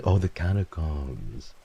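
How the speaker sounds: usual speaking voice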